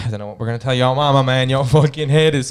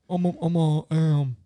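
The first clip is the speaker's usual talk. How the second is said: put-on American accent; 6 semitones higher, 4 dB quieter than usual.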